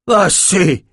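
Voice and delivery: crié, bien plus aigu et plus fort; cri. A narrator's reading. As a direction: coupe court au carnage d'un cri. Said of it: "cria Nathan". (Nathan face au massacre, cri d'arrêt)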